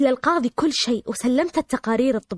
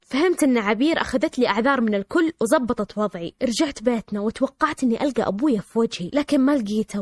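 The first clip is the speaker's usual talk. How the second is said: ordinary delivery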